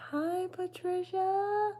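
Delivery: very high, slow sing-song hello; high sing-song greeting. Real, not acted.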